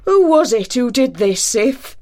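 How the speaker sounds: raging. Acted. Thor rages at whoever cut his wife's hair. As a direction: rage and vow revenge. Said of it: "raged Thor".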